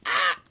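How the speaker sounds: high sudden yelp; startled yelp. Retold or imitated. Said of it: "he's like".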